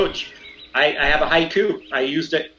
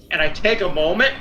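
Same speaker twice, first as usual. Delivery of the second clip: usual speaking voice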